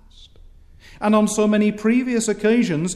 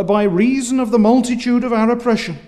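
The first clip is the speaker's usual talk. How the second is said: louder, fast, insistent; indignant outcry; 6 semitones higher, 7 dB louder, faster than usual. Shown as a demonstration. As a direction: cry it out as if wronged. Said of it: "crying out in a petulant way".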